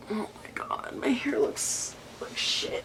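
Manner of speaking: unvoiced, hushed aside; soft whispered aside about her hair. Real, not acted. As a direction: whisper it to yourself.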